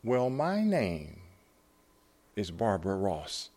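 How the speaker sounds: slow, halting, lightly imitated frail voice; hint of a fragile voice on the phone; hollow, fragile. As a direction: draw the name out gently, with a long pause. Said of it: "sort of hollow, fragile".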